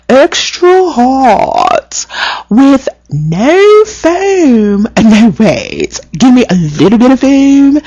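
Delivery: high, slow and haughty, every word drawn out; snooty customer voice. Retold or imitated. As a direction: play the fussy rich regular ordering her latte, nose in the air. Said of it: "she talks like this".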